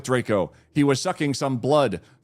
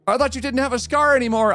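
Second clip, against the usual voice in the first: shouted; 11 semitones higher, faster than usual. A narrator's reading. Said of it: "I shouted".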